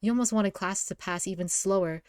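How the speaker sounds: ordinary delivery, nothing marked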